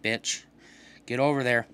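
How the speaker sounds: normal talking voice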